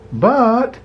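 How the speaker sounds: high startled protest; pleading but. A narrator's reading. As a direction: cut in, trying to explain. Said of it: "Flick tried to explain".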